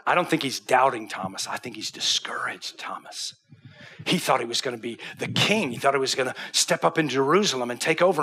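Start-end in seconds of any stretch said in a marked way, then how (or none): 1.5-3.3 hushed unvoiced aside; a confiding whisper to the congregation. Real, not acted. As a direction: lean in and whisper it. Preacher drops to a whisper.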